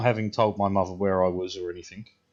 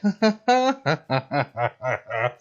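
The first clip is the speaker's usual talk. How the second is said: high stoned giggling fit; stoned giggle; 5 semitones higher, 4 dB louder than usual. Retold or imitated. Son laughing at mum and police while stoned, high giggle. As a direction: giggle helplessly like you're high. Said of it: "I was just like".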